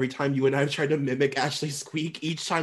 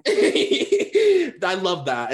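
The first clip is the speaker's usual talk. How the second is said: laughing; 5 semitones higher, 7 dB louder, much slower than usual. Real, not acted.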